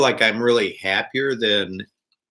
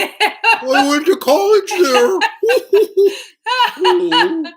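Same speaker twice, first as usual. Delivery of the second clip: laughing; 5 dB louder, much slower than usual. Real, not acted. Laughing reply to a place name.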